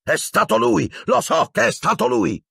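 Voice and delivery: very high, frenzied screech; hysterical accusation. A narrator's reading. Professor blaming the boy, hysterically furious. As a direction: insist at the top of the range. Professor raging on after his shriek.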